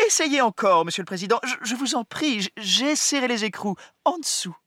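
stammering, high and anxious; a flustered officer tripping over words; nervous stammer. Acted. General stammering to president.

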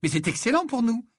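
put-on cheerful, high girl's voice; delighted. A narrator's reading. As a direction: say it gleefully, voice high. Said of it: "d'un ton réjoui".

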